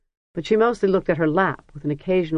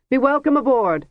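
clear, ringing welcome, pitched higher; 8 semitones higher, 4 dB louder than usual. A narrator's reading. Lebanon welcoming the princess aboard, ringing and clear. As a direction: ring it out to the crowd. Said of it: "said in a ringing voice".

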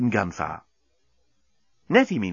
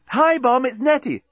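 bright call; 13 semitones higher, 3 dB louder than usual. Acted. Nettie phones the bomb.

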